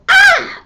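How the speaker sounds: very high, loud deliberate scream; voluntary scream demo. Shown as a demonstration. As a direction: scream on purpose in anger. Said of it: "But if they go".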